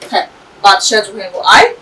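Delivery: plain reading voice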